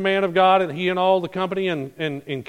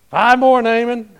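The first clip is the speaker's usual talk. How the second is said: high, loud cheering shout; excited call; 7 semitones higher, 8 dB louder than usual. Acted. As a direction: egg him on, counting down. Servants cheering him on.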